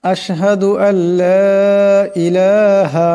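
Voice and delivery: measured, chanted recitation of the tashahhud; drawn-out devotional chant; tajweed recitation. Shown as a demonstration. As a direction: recite it slowly and melodically, as in prayer. Full recitation of the shahada.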